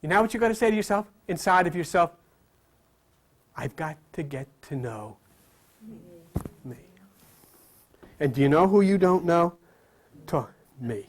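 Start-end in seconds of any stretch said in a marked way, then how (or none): 3.5-6.8 much lower and much softer, inward; said inwardly. Shown as a demonstration. Preacher modelling what to say inside yourself, hushed. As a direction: murmur it as if to yourself.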